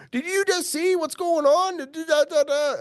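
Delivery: high, breathless mock caller voice; imitating frantic colleagues on the phone; excited callers. Geologist mimics colleagues phoning about the staking news, high and excited. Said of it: "they're going".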